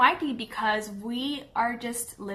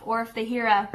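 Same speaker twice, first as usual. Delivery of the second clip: ordinary delivery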